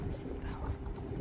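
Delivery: breathy whisper to himself. Real, not acted. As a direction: whisper it under your breath. Muttering to self while checking speed.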